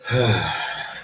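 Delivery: long weary sigh through the nose; heavy sigh. A narrator's reading. Ethan sighs looking at the moon, weary sigh. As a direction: let out a tired sigh. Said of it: "With a sigh".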